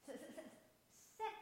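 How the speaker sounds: stuck on the s, then bursting out the word; stuttering. Acted. Sam answering the teacher, stuttering then getting it out. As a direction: stutter on s and finally land it. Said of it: "said Sam".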